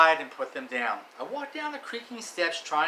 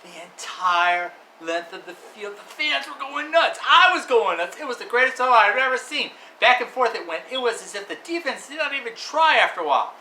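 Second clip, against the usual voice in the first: much louder, higher game call; excited play-by-play; 6 semitones higher, 10 dB louder than usual. Acted. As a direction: build the hype of the huge throw.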